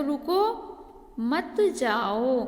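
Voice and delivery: रुको rising, pause, then drawn-out मत जाओ; slow teacher's demo, मत joined to जाओ after a gap. Shown as a demonstration. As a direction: break after रुको, stretch मत जाओ slowly. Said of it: "रुको के बाद अल्प विराम लगा है".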